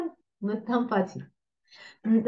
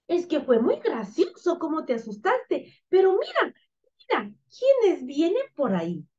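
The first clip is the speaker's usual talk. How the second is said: put-on high, lively puppet girl; 6 semitones higher than usual. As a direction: play an amused little girl, pitched up. Girl puppet answers, points out arrivals. (niña títere con voz aguda)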